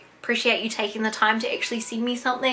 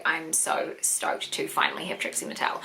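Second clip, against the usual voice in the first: much softer, lower, whispered delight; whispered glee; 8 semitones lower, 8 dB quieter than usual. Real, not acted. Admiring her new Trixie doll, a whispered glee. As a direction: hush it like a secret joy. Quiet aside over the doll.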